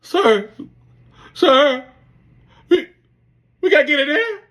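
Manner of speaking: high, loud blubbering plea; imitating ugly crying. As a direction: whimper it like a big man breaking down. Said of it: "ugly baby crying".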